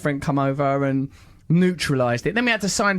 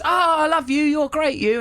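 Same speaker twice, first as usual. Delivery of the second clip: gushing fan woman; 10 semitones higher than usual. Retold or imitated. Older woman gushing at the comedian. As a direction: gush over him like an excited fan. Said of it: "The woman goes".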